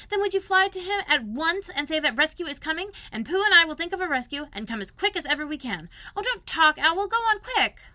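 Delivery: put-on high, loud boyish urgency; a small boy rushing to organise a rescue; urgent boy. Christopher Robin urging Owl to rescue Piglet, high and loud.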